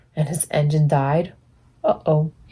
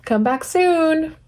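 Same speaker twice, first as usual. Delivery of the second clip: warm farewell; 9 semitones higher, slower than usual. Acted. Story ending.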